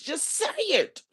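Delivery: high, loud exasperated cry; exasperated outburst. Retold or imitated. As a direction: beg her to just get to the point. Exasperated with rambling speaker.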